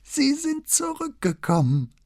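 put-on very high old man's voice, full of joy; old notary overjoyed at a visitor; delighted old man. A narrator's reading. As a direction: welcome him back with high-pitched delight. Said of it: "mit allen Anzeichen der Freude".

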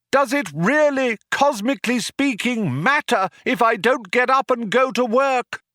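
high, loud rally speech; cheerleader. A narrator's reading. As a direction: rouse the crowd over a tannoy. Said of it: "cried the man".

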